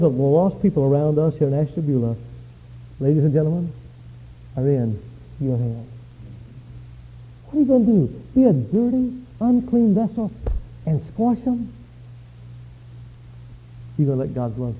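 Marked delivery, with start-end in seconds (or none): none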